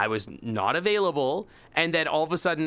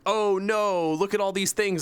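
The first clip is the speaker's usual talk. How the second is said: higher, hammed-up alarm; mock panicked coworkers; 7 semitones higher than usual. Coworkers finding risks they were told about, mocking panic. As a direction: play their fake panic big. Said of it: "And then they're like".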